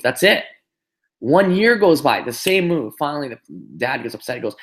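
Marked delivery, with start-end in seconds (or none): 0.0-2.9 high, slow, pressing each beat; emphatic storytelling. Real, not acted. Speaker telling the call how the practice dragged on, emphatic. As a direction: stretch it out to show how long it took. Stressing endless repetition.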